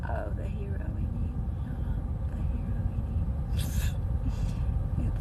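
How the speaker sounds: very soft whispered read; reading under breath. Real, not acted. Streamer reading the chat to herself, whispering. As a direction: whisper it to yourself, barely voiced.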